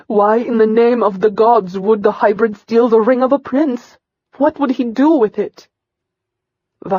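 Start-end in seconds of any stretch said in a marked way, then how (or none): 0.1-5.7 indignant. Acted. Wife challenges angry husband.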